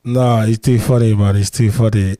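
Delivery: loud, cracking-up "he's too funny"; laughing loudly. Real, not acted. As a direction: blurt it out loud through laughter. Cracking up at the clip.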